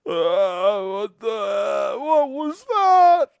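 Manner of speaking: high startled shout; shocked merchant. Acted. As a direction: yelp in alarm at what you saw. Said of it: "The merchant is holding his stomach going".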